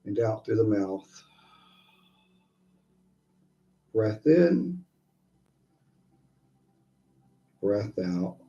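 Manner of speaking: soft, unhurried breathing guidance; guided meditation. Acted. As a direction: ease them into each breath.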